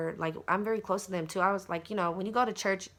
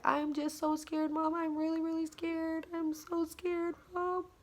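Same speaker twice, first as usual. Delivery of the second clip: high, soft, slow frightened plea; retold fear; 10 semitones higher, 5 dB quieter than usual. She tells mom her feelings, small frightened voice. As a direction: plead in a small scared child-like voice. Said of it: "I was going through those feelings".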